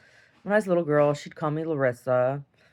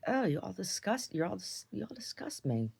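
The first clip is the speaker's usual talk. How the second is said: upset; 5 semitones higher, 8 dB quieter than usual. Retold or imitated. Retelling her upset outburst.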